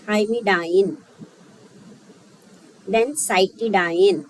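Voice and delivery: thymidine then cytidine read slowly, word by word; teacher reading names aloud slowly; slow dictation. Shown as a demonstration. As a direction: spell out the names slowly for the class. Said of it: "इसको कैसे पढ़ेंगे आप?".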